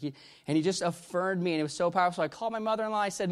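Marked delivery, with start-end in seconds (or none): none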